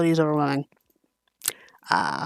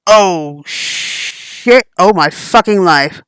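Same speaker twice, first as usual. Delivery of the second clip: louder, slow freaking out; panicked outburst; 5 dB louder, much slower than usual. Acted. After learning the truth, loud slow panic. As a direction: spiral into panic.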